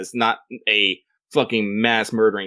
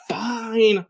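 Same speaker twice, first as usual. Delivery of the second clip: sulky fine; 12 semitones higher than usual. Retold or imitated. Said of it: "she's like".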